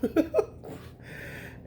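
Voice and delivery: high, soft breathy giggle; laugh. Real, not acted. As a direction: giggle at your own remark. Laughs at own voice remark.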